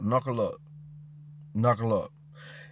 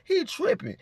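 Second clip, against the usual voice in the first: high, slow, sing-song mockery; high mocking voice, slowed; mocking quote; slower than usual. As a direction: mimic them calling him crazy, drawn out and high. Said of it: "They say".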